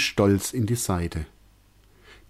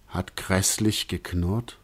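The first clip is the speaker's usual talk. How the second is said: ordinary delivery, nothing marked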